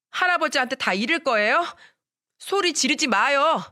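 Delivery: put-on a little boy shielding his mom, near tears; young son defends mom from grandmother, high pleading child voice; small boy pleading. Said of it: "이렇게 말을 합디다".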